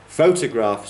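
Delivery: photograph with its first syllable leaning out; first-syllable stress. Shown as a demonstration. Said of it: "it's the first syllable".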